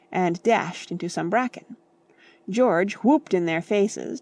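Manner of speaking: ordinary delivery, nothing marked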